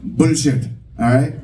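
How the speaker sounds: "Bullshit" short and falling, in an Indian accent. Shown as a demonstration. Comic showing how it should be said, Indian-accented "Bullshit". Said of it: "that certain Indian accent".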